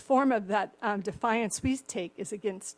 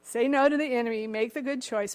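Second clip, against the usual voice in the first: ordinary delivery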